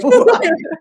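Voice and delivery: high, loud sneering villain; evil voice. Pretend. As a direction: squeal it like a mock evil scold. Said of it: "I sound like a little evil".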